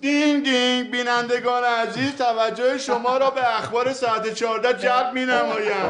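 slow, formal TV news read with a jingle; mock news anchor. Ex-footballer mocking a newsreader to his rival, solemn news voice. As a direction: announce it like a stiff news presenter. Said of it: "این کار داره اخبار میگه".

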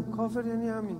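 soft echo. Acted. Preacher echoing his point softly. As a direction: let it fall away to a hush. Softer echo of previous line.

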